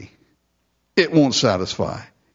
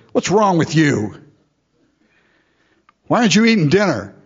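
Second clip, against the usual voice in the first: a touch of scolding, faster and higher; sharp nagging wife; 5 semitones higher than usual. Retold or imitated. Wife scolding her sulking husband at dinner, sharp and impatient. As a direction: snap at him to eat his dinner. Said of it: "My guess is she said".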